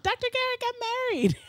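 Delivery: high, sing-song gossip squeal; imitated gossiping nurses. Nurses gossiping at the hospital, high mock excitement. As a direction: spill it high like juicy gossip.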